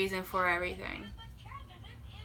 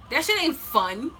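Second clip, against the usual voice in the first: high, loud exasperated declaration; loud outburst; 5 semitones higher, 4 dB louder than usual. Real, not acted. Ranting to viewers about competing for a twin flame, loud and exasperated. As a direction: blurt it out, fed up.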